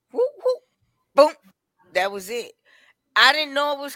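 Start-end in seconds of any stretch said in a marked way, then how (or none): 0.1-1.3 high whooped sound effects, then a short boom; imitated attack noises. Woman retelling how she slammed someone's head, mimicked whoops and boom. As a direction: voice the sudden blows as quick noises.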